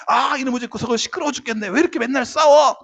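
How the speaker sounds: acted fed-up kid, rising to a shout; angry outburst. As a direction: yell in exasperation at fighting parents. Acted child yelling at fighting parents.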